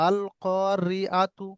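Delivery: very slow, each syllable drawn out; slow recitation. Shown as a demonstration. Teacher reciting the Quran word slowly for students. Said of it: "মুখটা ছড়িয়ে যাচ্ছে".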